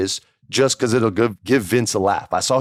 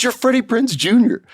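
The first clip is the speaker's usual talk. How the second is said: sudden high burst of recognition; surprised; 4 dB louder than usual. Retold or imitated. Cameraman recognizing the new guy, high surprised cry. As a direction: jump up high, suddenly realizing who he is.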